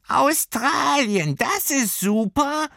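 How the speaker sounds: Australien drawn out very high and slow; delighted little creature. Acted. Creature happily agreeing with Lilli, very high and slow. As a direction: echo Australien like an excited tiny critter. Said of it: "stimmte ihr das Fernweh zu".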